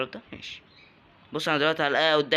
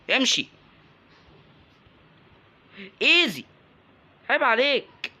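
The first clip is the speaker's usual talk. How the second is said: excited taunts; 9 semitones higher, 5 dB louder, slower than usual. Real, not acted. Taunting enemies mid-fight.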